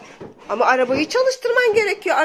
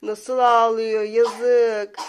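mock pity; 4 semitones higher, slower than usual. Pitying the crying toy.

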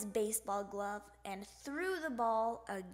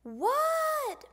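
high, loud, drawn-out call back; hollered reply; 14 semitones higher, 8 dB louder than usual. A narrator's reading. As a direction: yell back across the house. Froggy answers his mother's call.

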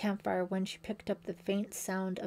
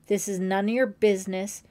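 angry warning; 7 dB louder than usual. A narrator's reading. John warns Holly off.

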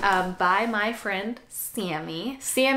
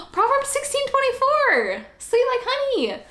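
excited; 3 dB louder than usual. Real, not acted. Excited unboxing reveal.

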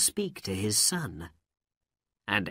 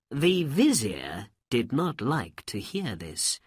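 plain reading voice

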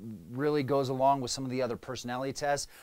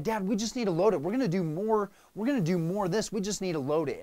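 urging dad; 6 semitones higher, faster than usual. Retold or imitated. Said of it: "my dad".

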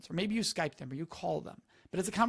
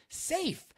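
safe lifted much higher; stress on safe; 9 semitones higher than usual. Shown as a demonstration. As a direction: pitch safe up high and let it fall.

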